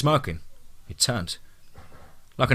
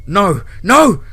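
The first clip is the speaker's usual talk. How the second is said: higher, louder anguished cry; desperate yell; 9 semitones higher, 9 dB louder than usual. Acted. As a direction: cry out as the line goes dead. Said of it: "Brady yelled into his phone".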